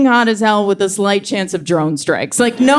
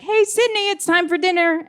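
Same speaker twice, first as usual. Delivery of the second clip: much higher, softer sing-song call; sweet mom calling; 10 semitones higher, 3 dB quieter than usual. Retold or imitated. Mom calling her toddler to dinner, high and soft. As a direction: call the child in like a gentle mother. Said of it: "his mom was like".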